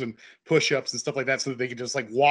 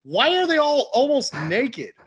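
high, drawn-out mock bewilderment; baffled mom; 10 semitones higher, 4 dB louder, slower than usual. Son retelling mom walking in on the movie, baffled. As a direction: imitate a baffled mother, slow and high-pitched. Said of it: "And she just goes".

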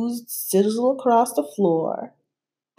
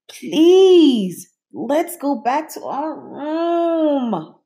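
pleading; 8 semitones higher, 4 dB louder, much slower than usual. A narrator's reading. Child pleading with monster.

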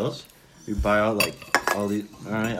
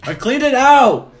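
much higher, loud call across the room; shouting; 11 semitones higher, 10 dB louder, slower than usual. Real, not acted. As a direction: yell it out to a friend.

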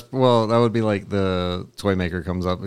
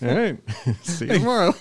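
laughing; 11 semitones higher than usual. Acted. Writers shrug off absurd toy.

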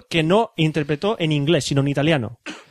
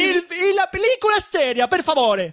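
high, playful fake Italian; mock Italian accent; 13 semitones higher than usual. Host repeating his plea to be serious in a mock Italian accent. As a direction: plead in a hammy Italian accent. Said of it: "con acento italiano y haciendo coña".

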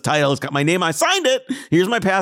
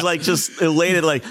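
normal talking voice

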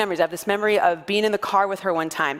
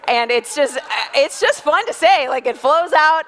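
ordinary delivery

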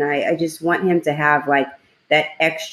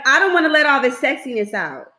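higher, joking self-quote; hammed-up playful boast in a raised voice; playful mock vanity; 9 semitones higher than usual. Host jokes about wearing mask and sunglasses, playful mock vanity. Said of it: "No, I'm just playing".

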